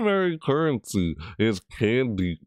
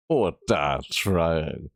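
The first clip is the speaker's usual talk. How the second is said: slow spooky read; 4 semitones lower, much slower than usual. Pretend. Rereads spooky line.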